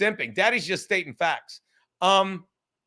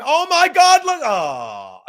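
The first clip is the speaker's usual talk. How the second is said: high, loud shocked shriek of joy; shocked shout; 6 semitones higher, 7 dB louder, slower than usual. Real, not acted. Streamer shrieking at a huge donation, high and loud. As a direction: screams oh my God at a big tip.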